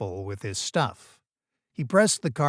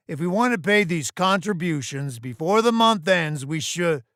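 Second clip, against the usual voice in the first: raised, higher voice addressing the meeting; businesslike boss; 7 semitones higher, 4 dB louder than usual. Acted. Boss turning to contributions before month's end, brisk authority. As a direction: move the meeting on in a high, carrying voice.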